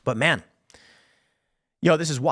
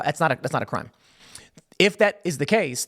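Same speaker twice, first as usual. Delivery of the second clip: ordinary talk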